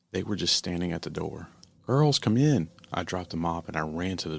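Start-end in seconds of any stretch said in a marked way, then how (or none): 1.9-2.7 loud, high shout to come inside; urgent call. Acted. Mother calling her daughters in from the door, loud urgent shout.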